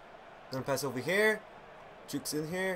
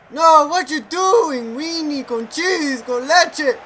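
exasperated yell; 5 semitones higher, 7 dB louder, slower than usual. Real, not acted. Frustrated at a miss.

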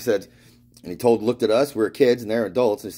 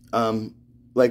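ordinary delivery, nothing marked

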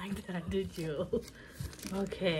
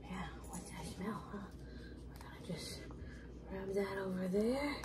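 soft whisper to the dog; 9 dB quieter than usual. Real, not acted. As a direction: murmur while swabbing. Swabbing dog, hushed.

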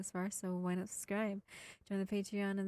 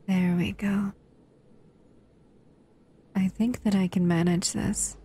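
gentle intimate roleplay voice, close to the mic; 13 dB louder than usual. Acted.

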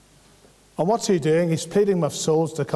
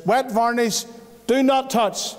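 raised, emphatic warning; stern warning sign; 6 semitones higher, slower than usual. Retold or imitated. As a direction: read the sign like a firm warning. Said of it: "it says".

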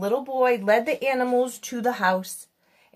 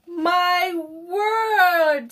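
high, drawn-out storybook amazement; amazed exclamation; 8 semitones higher than usual. A narrator's reading. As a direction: exclaim it in wonder as the bird. Bird amazed at the baby.

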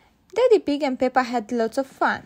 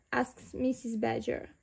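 ordinary delivery

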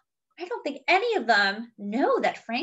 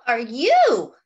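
startled question; 8 dB louder than usual. Acted. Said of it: "asked the girl".

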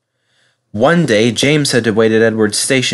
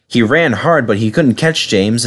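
ordinary delivery, nothing marked

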